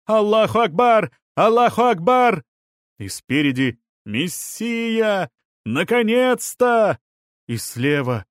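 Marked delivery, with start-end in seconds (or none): 0.0-2.4 high, loud shout from the crowd; ringing high-pitched yell; loud cry. A narrator's reading. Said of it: "донёсся громкий крик". 4.0-7.0 high, stretched shout of joy; much higher, fervent exclamation; ecstatic cry. A narrator's reading. Ecstatic crowd crying out.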